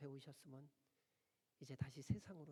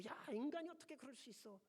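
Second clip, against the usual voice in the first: mock indignation; 9 semitones higher than usual. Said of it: "딴 사람이 이런 거 보면은".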